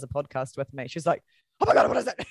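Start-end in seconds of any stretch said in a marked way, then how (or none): 1.6-2.3 high, loud frantic panic; panicked. Retold or imitated. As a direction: shriek it in alarm. Sister panicking on the phone.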